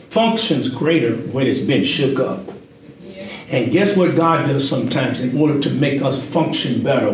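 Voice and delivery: normal talking voice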